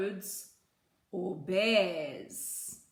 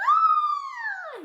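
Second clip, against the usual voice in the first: high loud cry of delight; excited shout; 8 dB louder than usual. Acted. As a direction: yelp with greedy joy. Bear bursts out at honey.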